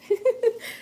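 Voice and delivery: high, bright burst of laughter; real laugh. Real, not acted. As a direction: laugh at yourself, amused. Laughs after quick meeting.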